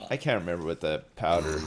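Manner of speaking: ordinary talk, nothing marked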